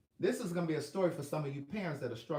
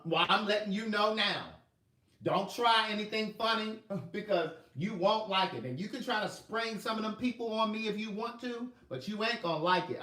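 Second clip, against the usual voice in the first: mother's warning; 7 semitones higher, 5 dB louder than usual. Retold or imitated. Mother threatening on phone.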